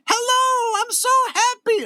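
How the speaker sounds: very high sweet greeting; Mister Rogers voice. Retold or imitated. Host voicing a hidden greeter behind a photo, high sweet Mister Rogers voice. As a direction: coo it gently like a kids' show host. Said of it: "behind I'll be going".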